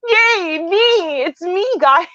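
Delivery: high squeaky self-cheer; excited cheer. Retold or imitated. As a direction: celebrate yourself like a kid. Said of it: "It's like".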